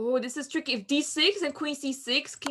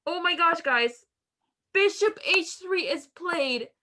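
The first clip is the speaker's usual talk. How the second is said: excited; 6 semitones higher, 5 dB louder, slower than usual. Real, not acted. Live commentary on a sudden twist.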